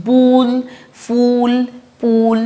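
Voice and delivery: "বুল, ফুল, পুল" each with a held oo. Shown as a demonstration. Teacher recapping for learners, oo in "বুল, ফুল, পুল". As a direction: sound out "বুল, ফুল, পুল" separately, rounded oo. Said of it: "এইভাবে উচ্চারণ করছি".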